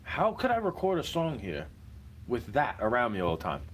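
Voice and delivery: ordinary talk, nothing marked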